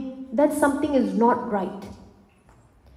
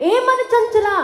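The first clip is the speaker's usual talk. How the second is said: scolding; 10 semitones higher than usual. Acted. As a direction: scold your own restless mind. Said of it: "scold your mind".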